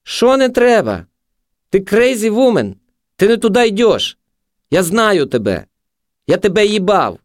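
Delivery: high, strident taunting; high-pitched abusive jeering; aggressive junkie. A narrator's reading.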